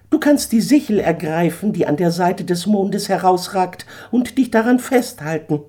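a proud eagle laying down terms; haughty eagle. Acted. Eagle urges Daniel off the sinking stone, high and haughty.

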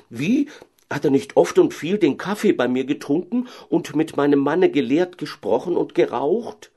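high, agitated doctor's wife; heated outburst. Acted. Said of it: "eiferte die Frau Doktorin". (eifernd, empört)

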